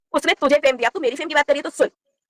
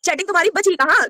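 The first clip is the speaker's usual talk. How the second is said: angry outburst; 7 semitones higher than usual. Real, not acted. Mocking the rival over leaked photos, angry raised voice. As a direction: pitch jumps up, scornful angry retort. Heated live fight with rival.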